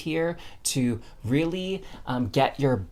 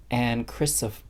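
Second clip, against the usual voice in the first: ordinary delivery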